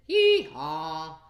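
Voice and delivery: loud hee haw bray; donkey bray. Acted. Reading to a child, a loud braying donkey. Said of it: "And the donkey said".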